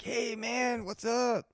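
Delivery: high, bright-eyed hello to a friend; excited greeting. Retold or imitated. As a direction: call out happily, mildly hammed. Greeting dealer on street.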